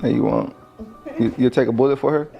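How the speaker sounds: usual speaking voice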